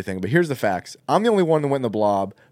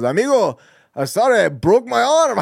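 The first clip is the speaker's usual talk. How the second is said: higher, louder mock accent; retelling the guide's joke, hammed up; imitating the guide; 9 semitones higher, 5 dB louder than usual. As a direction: ham up the guide's broken English. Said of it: "and he goes".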